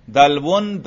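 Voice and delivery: دلون said as "dalwun"; nominative ending. Shown as a demonstration. Teacher showing three audible case endings, nominative first. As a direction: end دلون in a clear "-un". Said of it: "تینوں طرح کے اعراب لفظی آتے تھے".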